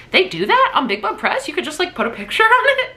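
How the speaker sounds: incredulous. Retold or imitated. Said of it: "And I was like".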